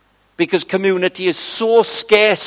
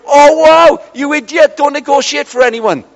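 high, loud self-scolding; exasperated outburst, pitched up; mock exasperation; 6 semitones higher, 4 dB louder than usual. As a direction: berate yourself in acted dismay. Acted inner voice scolding himself.

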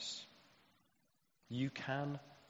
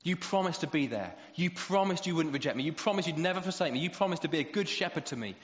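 insistent psalmist; 5 semitones higher, 6 dB louder than usual. Acted. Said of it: "He's saying".

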